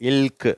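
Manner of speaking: இல்க் with L running straight into K; L-K blend. Shown as a demonstration. Teacher demonstrating a letter blend, இல்க் with L and K joined. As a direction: sound out இல்க் as one blend. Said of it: "இல்க் அப்படிங்கிற sound வருது".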